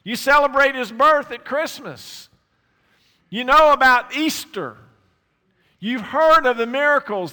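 loud, high preaching at full force; fervent pulpit delivery. Acted. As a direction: drive every line home to the congregation. Preacher addresses congregation.

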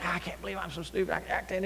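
hushed mock agony, holding the yell in; stifled self-scolding. Preacher acting out pain without waking everybody, stifled self-scolding. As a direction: berate yourself under your breath. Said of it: "if you start yelling and hollering".

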